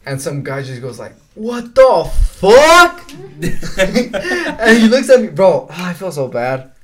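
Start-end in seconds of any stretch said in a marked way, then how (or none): 1.3-3.1 high, loud, slow outraged yell; shocked yell. Retold or imitated. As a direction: stretch it out, higher and louder, like a stunned stranger. Bystander reacts to the crash.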